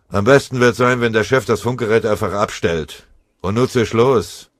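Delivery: loud, curt dismissal; gruff. Acted. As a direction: send him off with a loud growl of contempt. Sergeant dismisses the runner.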